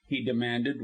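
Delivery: ordinary delivery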